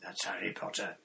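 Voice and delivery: put-on gruff old auror growl. A narrator's reading. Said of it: "said a growling voice from behind them".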